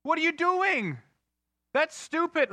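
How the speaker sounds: high, loud cry of disbelief; outraged yell. Retold or imitated. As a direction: explode like fans at a bad play. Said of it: "My whole couch exploded".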